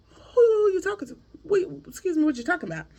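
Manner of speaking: mock outrage at what she just overheard; startled demand. Woman peeking out the door, startled at him.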